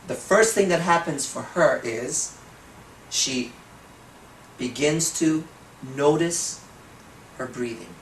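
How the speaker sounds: slow, drawn-out hypnotic pacing with long pauses; slow trance voice. Shown as a demonstration. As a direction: ease the listener into a trance. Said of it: "if I'm talking like this".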